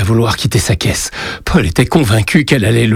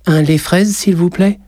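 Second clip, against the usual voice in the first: put-on higher child's voice, shy; little boy; 10 semitones higher than usual. A narrator's reading. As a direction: voice a small boy giving his name. Boy orders a drink. (petit garçon timide, voix aiguë)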